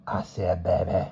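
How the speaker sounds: put-on soft coaxing old crone; cajoling old woman. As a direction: coax gently like an old woman. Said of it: "she said cajolingly".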